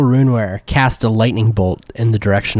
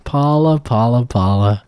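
slow, lazy drawl; 4 semitones higher than usual. Acted. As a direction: stretch every word out lazily. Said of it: "You're talking so slow".

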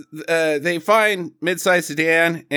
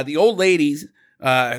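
ordinary delivery

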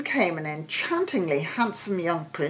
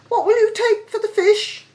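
very high, louder questioning servant; slave girl's voice; 5 dB louder than usual. A narrator's reading. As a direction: ask in a high, pert voice. Slave questions the old pedlar.

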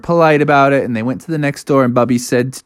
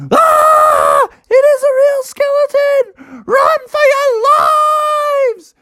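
high, loud shriek of alarm; terrified screaming; 4 dB louder, slower than usual. Acted. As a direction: scream for everyone to run. Kids flee in terror.

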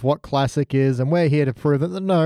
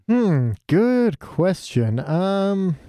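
slow, drawn-out pondering; thinking hmm; 4 semitones higher, much slower than usual. Real, not acted. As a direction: stretch the hmm while thinking. Pondering the host's question.

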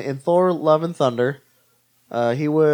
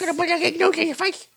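silly squeak. Pretend. Host playfully echoing co-host before news, squeaky voice. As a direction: parrot it in a tiny falsetto.

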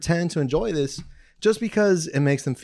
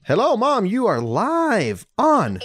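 mock announcer greeting; 7 semitones higher, slower than usual. Real, not acted. Answering mom's call on air.

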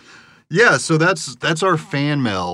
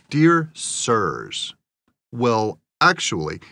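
snarky; much slower than usual. Retold or imitated. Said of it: "in quotes to show how snarky it is".

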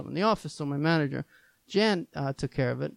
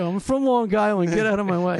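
loud, high, brash tourist voice; rude Long Islander; 6 semitones higher, 10 dB louder than usual. Retold or imitated. Mocking a pushy Long Island tourist, loud and high. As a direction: bark it like an entitled out-of-towner.

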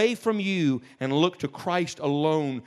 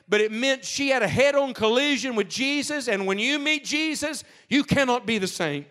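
higher and louder, building sermon climax; preaching at full force; 7 semitones higher, 5 dB louder than usual. Acted. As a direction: lift the voice and drive it home to the congregation.

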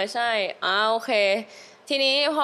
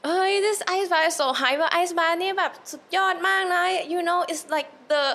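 higher-pitched, drawn-out mock sales pitch; imitated gushing endorsement, breaking into amusement at the end; imitating hyped-up enthusiast; 6 semitones higher, slower than usual. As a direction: hype it up like an overeager fan retelling the pitch. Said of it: "he ก็แบบ อธิบายให้ฟังว่าแบบ".

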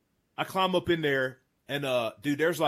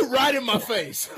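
high shrieking laugh around the words; excited laughing; 4 dB louder, slower than usual. Real, not acted. Hunter reliving the bull appearing, laughing excitedly. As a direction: laugh out in disbelief.

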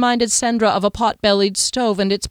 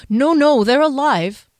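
louder, higher, quick to correct him; urgent reassurance; 6 semitones higher, 6 dB louder than usual. A narrator's reading. Shopkeeper correcting the rider's fear, urgent reassurance. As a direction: jump in with an insistent denial. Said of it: "Her voice tightened".

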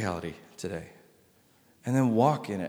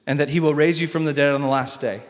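ordinary talk, nothing marked

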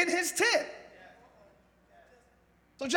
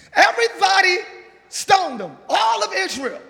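preaching at full force; 8 dB louder than usual. Acted. Preacher rallying the church to throw their stones, shouting. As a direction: whip up the congregation.

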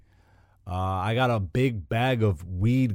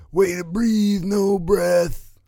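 put-on higher Simpsons bully accent, quoted quickly; Jimbo accent; 7 semitones higher, much slower than usual. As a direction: toss off the Jimbo line in his accent. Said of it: "And then Jimbo's like".

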